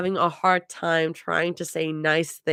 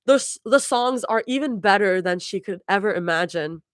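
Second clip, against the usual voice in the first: usual speaking voice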